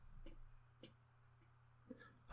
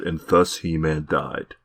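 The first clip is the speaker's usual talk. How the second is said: narrator voice; slower than usual. Retold or imitated. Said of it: "a narrator came out and said".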